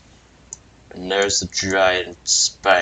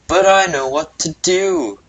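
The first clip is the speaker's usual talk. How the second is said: higher, louder heroic declaration; confident hero; 8 semitones higher, 5 dB louder than usual. Acted. Hero announcing his plan to save the elephant, bold and louder.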